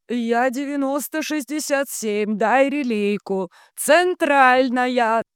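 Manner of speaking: hollering entranced operator; chesty bellow. A narrator's reading. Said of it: "а орёт низким грудным голосом".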